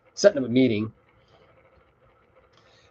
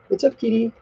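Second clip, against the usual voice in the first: pet talk; 12 semitones higher than usual. Real, not acted. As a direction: coo at the kitty. Talking to the cat.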